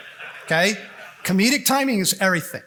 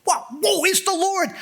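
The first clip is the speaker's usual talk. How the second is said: much higher, awed; astonished; 11 semitones higher than usual. Retold or imitated. Disciples realizing it was the Lord, astonished. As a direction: gasp it out in wonder. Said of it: "They go".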